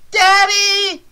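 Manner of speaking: frantic yell with a cracking voice; yelling, voice breaking. A narrator's reading. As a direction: scream for her missing father. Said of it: "she yelled, her voice breaking".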